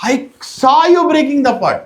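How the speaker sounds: high, loud accusation; loud accusing mother. Acted. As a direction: call him out as the angry mother.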